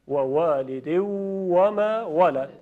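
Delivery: very slow, each «و» a rounded w; slow recitation. Shown as a demonstration. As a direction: draw out «و والد و ما ولد».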